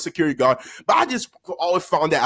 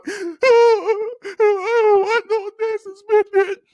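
high, drawn-out bawling; loud, hammed-up wail through fake tears; mock crying; 4 dB louder, much slower than usual. Boy starts crying over suspension days, mock crying. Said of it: "then he started crying".

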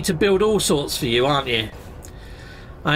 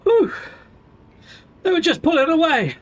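panting after running downstairs; breathless, gasping between words; out of breath; 10 semitones higher than usual. Real, not acted. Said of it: "I'm out of breath now".

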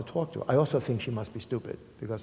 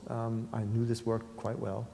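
ordinary talk, nothing marked

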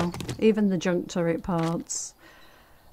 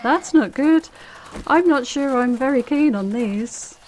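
alarmed; 6 semitones higher, 6 dB louder than usual. Real, not acted. Zombies spawn, alarmed reaction.

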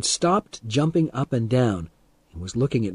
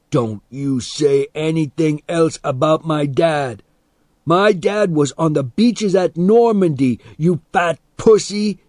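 raised, heated defiant retort; angry warning; 5 semitones higher, 4 dB louder than usual. A narrator's reading. Boy defends his war-veteran dad, angrily. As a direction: build from warning to loud insult.

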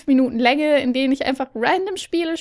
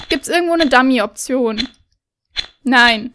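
ordinary talk

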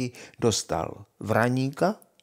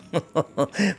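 higher burst of derisive laughter; mocking laugh; 5 semitones higher than usual. A narrator's reading. Brothers laugh at foolish Honza, mocking laugh. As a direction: laugh in the fool's face. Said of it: "Bratři se mu vysmáli".

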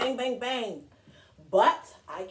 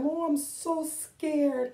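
scared; 5 semitones higher, slower than usual. Retold or imitated. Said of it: "afraid was his first feeling".

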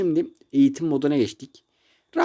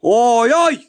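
loud exclamation; 4 semitones higher, 7 dB louder than usual. Real, not acted. Reacting to game.

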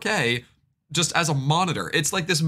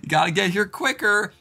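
office joker; 7 semitones higher, 3 dB louder than usual. Retold or imitated. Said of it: "thinks they're super funny for saying".

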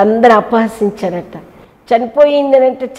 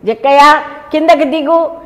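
louder and higher, calling up to someone; calling up to a tree; 8 semitones higher, 5 dB louder than usual. Retold or imitated. Jesus calling Zacchaeus down from the tree, loud and high. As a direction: call him down from the branches. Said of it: "అన్నాడు".

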